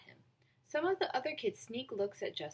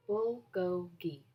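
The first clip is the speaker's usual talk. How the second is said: slow clear repeat; 2 semitones lower, much slower than usual. Acted. Justin repeats the word slowly.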